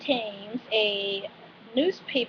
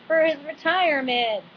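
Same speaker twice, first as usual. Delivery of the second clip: "retirement" in a higher country twang; hammed-up country "retirement"; playful country accent; 5 semitones higher than usual. Shown as a demonstration. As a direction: redo "retirement" with a country lilt. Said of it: "kind of weird and country".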